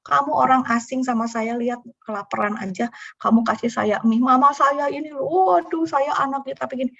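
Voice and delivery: put-on high, tearful child's voice; a touch of sobbing runaway child; runaway boy tearfully tells the noodle seller, high and tearful; child crying. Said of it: "Oh, anaknya nangis".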